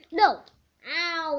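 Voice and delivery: sudden loud cry of no; shout. Acted. Hurt character yells no. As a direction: yell it out in pain.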